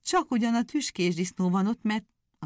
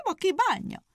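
high shout from the hare; 13 semitones higher than usual. Acted. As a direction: call it out, carefree. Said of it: "kiáltott a nyúl".

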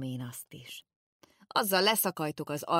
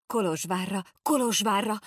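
higher, louder Kolozsvárra repeated in alarm; excited outburst; 5 semitones higher, 6 dB louder than usual. A narrator's reading. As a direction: jump up crying Kolozsvárra, Kolozsvárra. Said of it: "ugrott fel a két gyerek".

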